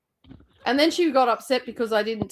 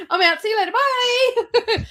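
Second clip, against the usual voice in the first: mock-cheery goodbye; 12 semitones higher, 4 dB louder, slower than usual. Cheerily leaving after being thrown out.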